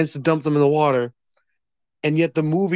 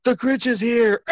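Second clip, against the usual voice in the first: higher, panicked Who cry; mock alarmed townsfolk; 10 semitones higher than usual. A Who warns the town, high panicked mock cry. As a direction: shout it like a frightened villager. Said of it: "where they're like".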